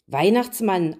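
ordinary delivery, nothing marked

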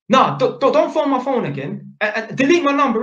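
high, loud, insistent refusal; retold angry demand. Tenant on the phone telling the electrician to delete his number, angry. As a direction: snap at the caller to stop calling. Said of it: "He said".

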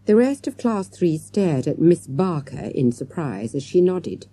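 ordinary delivery, nothing marked

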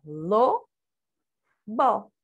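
LO, long pause, BO; each syllable of lobo on its own; separated syllables. Shown as a demonstration. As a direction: say lo and bo as two isolated syllables. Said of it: "Sílaba separada".

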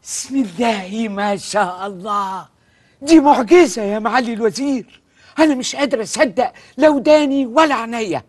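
slow, awed disbelief; amazed. Acted. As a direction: marvel slowly at a miracle. Minister amazed by student.

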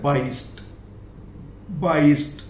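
बाईस्ट closed on a t; बाईस्ट with a voiceless t, not d; final t sound. Shown as a demonstration. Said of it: "यहाँ पे मैंने दिया ट".

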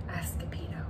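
ordinary delivery, nothing marked

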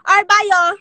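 loud, hurried farewell; loud sign-off. Real, not acted. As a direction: call goodbye to the whole crowd. Signing off to livestream viewers.

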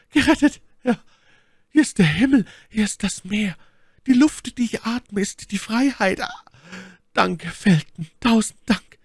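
very high falsetto, loud bursts of relief; elated My Lady. A narrator's reading. Freed lady thanking her rescuer, high and rapturous. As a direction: exclaim with rapture in a high lady's voice.

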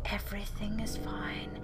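eerie unvoiced whisper. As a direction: breathe it out like a ghost. Creepy whispered game text.

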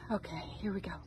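hushed whisper, no voice. Real, not acted. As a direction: whisper it as if not to be overheard. Whispered aside before tour.

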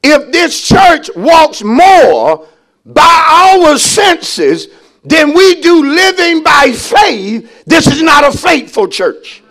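slow, weighty pulpit delivery; emphatic preaching. Real, not acted. Preacher warning the church about faith, emphatic preaching. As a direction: stretch the key words for the congregation.

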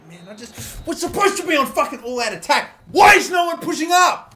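yelling at his players to push up; loud exasperated yell; frustrated shout. Real, not acted. Team not pushing in stoppage time.

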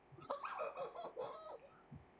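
high, breathless disbelieving laugh; stunned laugh. Real, not acted. Streamer laughing in disbelief at the huge donation. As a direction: laugh out in shock.

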